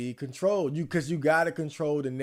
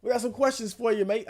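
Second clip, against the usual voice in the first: bad British cop accent, pitched much higher; terrible British accent; 10 semitones higher than usual. Streamer mocking UK police in a bad British accent. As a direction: ham up a British copper hauling someone off. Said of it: "I got a terrible British accent".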